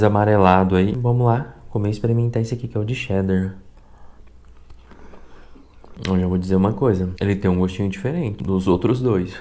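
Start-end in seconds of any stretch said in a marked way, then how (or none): 1.9-9.3 talking between bites; chewing while talking. Real, not acted. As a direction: mouth partly full of cracker. Seen 2: Ate crackers just before; mouth works while talking, cracker in hand.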